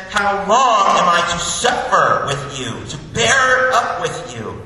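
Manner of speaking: loud, high, slow, exasperated cry; impatient, angry. Retold or imitated. Jesus fed up with bearing with them, angry and impatient. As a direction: stretch the key words in loud acted exasperation. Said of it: "He sounds impatient. He sounds angry. He sounds disappointed".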